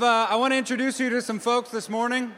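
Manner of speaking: ordinary talk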